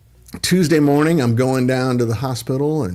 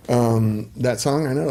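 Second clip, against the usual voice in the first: ordinary talk, nothing marked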